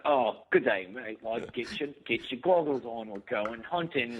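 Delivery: a hammed-up, terrible Australian accent; mock Aussie voice, half laughing; lightly put-on bad Australian accent; fake Australian accent. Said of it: "terrible Australian accents".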